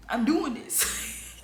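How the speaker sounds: slow, laughing "I'm doing this". Real, not acted. Recalling first pregnancy resolve, slow laughing. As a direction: say it slowly through a laugh. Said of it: "repeat of quote, laughing".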